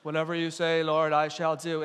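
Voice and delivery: low, even robotic monotone; droning mock obedience; obedient drone. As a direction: recite it like a mindless servant. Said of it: "Now I'm just a drone".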